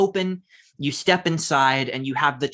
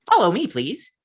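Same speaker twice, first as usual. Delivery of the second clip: robot voice; 6 semitones higher, 3 dB louder than usual. Acted. GM voices little robot guide.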